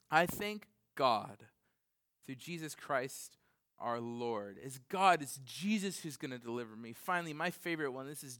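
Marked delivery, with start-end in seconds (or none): none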